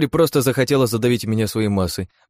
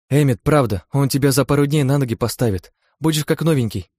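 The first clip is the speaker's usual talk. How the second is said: higher, hurried chatter; rattling on; 6 semitones higher, 4 dB louder than usual. Acted. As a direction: gabble to cover something up. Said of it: "затараторила она".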